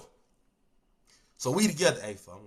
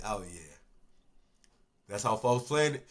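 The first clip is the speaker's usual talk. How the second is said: much softer, lower resigned remark; muttered aside; 10 semitones lower, 11 dB quieter than usual. Retold or imitated. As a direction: say it under your breath, resigned. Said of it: "And I said".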